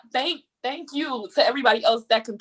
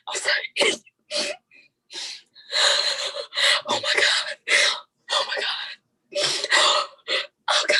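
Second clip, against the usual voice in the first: breaking whispers between sobs; ugly crying; 14 semitones higher than usual. Real, not acted. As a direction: barely get the words out through tears. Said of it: "I'm done ugly crying now".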